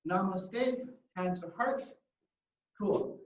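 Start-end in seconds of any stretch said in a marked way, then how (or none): none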